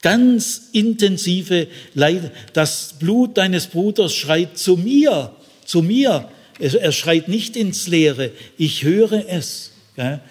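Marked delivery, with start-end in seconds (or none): 2.5-6.3 raised high and louder, anguished; wild, emotional cry. Shown as a demonstration. Preacher voicing God's anguished cry over the murdered brother, raised and louder. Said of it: "Dieser Satz ist sehr wild, emotional".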